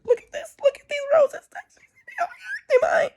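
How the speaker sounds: very high, loud excited squeal; hammed-up gushing. Showing family and friends the roses, mock gushing. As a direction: squeal it like you are showing off a prize.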